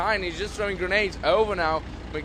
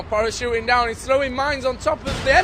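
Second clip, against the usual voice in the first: excited; 6 semitones higher, 5 dB louder than usual. Real, not acted. Casters react to a big play.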